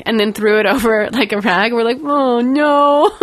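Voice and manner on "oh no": dismayed. Retold or imitated. Staff dismayed at dropped coat.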